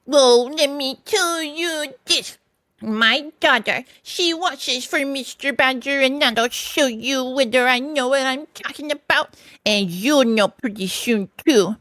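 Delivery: high, slow, ominous put-on voice; indignant washerwoman. A narrator's reading. Mole in disguise bluffing the stoats, emphatic. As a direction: spell out the threat with relish.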